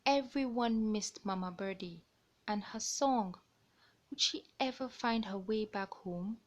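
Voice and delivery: lively picture-book narration; reading to children. A narrator's reading. Storyteller reading a bird tale to young listeners. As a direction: read it out like storytime.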